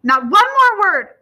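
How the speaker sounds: high, furious shout; mother shouting down all argument; fierce, terrible voice. A narrator's reading. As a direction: explode into a loud, high order. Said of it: "in a fierce and terrible voice".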